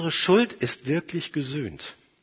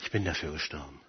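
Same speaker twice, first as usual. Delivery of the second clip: low, very soft, almost to oneself; hushed declaration; 11 semitones lower, 14 dB quieter, faster than usual. Acted. Jesus answers Satan for the believer, hushed and low. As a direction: say it quietly and low.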